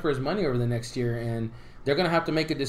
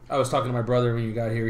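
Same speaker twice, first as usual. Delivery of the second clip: ordinary talk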